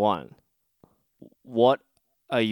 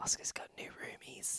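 whispering; 20 dB quieter than usual. Real, not acted. Keeping quiet for roommates.

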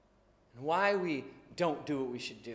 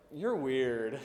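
lower, scornful put-down; mock preteen; 5 semitones lower than usual. Preteen brushing off an embarrassing parent, scornful jab. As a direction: sneer like a twelve-year-old.

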